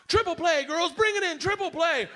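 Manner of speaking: high, celebrating coach call; excited coach. Retold or imitated. Coach calling the girls in after a play, excited.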